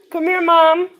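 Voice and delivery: loud, high call with rising end. Real, not acted. Daughter calling her mother to come here, calling out. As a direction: call Mom over loudly.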